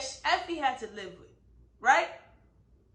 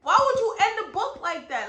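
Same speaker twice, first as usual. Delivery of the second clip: even higher, louder repeat of the complaint; mad at the book; 6 semitones higher, 7 dB louder than usual. Real, not acted. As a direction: push the outrage up a notch on would. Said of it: "I'm still so mad".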